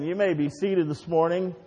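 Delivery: ordinary talk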